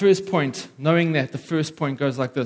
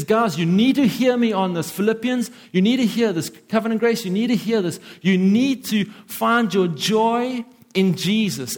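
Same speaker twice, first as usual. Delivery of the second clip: raised, higher pleading as Paul; voicing Paul's urgent appeal; urgent exhortation; 6 semitones higher than usual. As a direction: press it home to the church. Paul voiced urging the Philippians.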